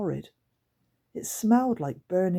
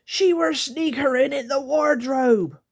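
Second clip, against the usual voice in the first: higher, louder creature accusing; 9 semitones higher, 6 dB louder than usual. Acted. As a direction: point her out with a creaky squawk. Grunt accuses the hiding girl.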